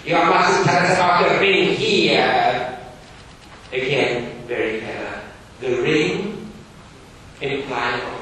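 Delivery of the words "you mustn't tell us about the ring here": loud, high, stern schoolmaster. Acted. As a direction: bark it like a strict Victorian teacher. Gradgrind voiced in reading.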